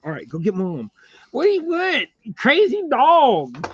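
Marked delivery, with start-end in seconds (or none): 1.3-3.7 much higher, louder, slow pet voice; baby talk to dog. Acted. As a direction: tease the dog fondly. Talking to the dog.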